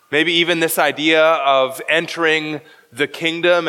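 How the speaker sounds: ordinary talk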